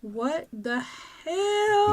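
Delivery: slow, stretched out what the hell; drawn-out exasperated. Retold or imitated. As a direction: draw it out in disbelief. Mom exasperated at night.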